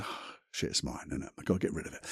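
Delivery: soft, almost breathless dawning realization; hushed realization. Retold or imitated. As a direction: drop to a near whisper as the penny drops. Angry man realizes in story.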